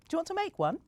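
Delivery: put-on high, bright coaxing question to a child. A narrator's reading. Mum inviting Bella to make snow angels, high coaxing. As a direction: invite her to play along. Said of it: "she said".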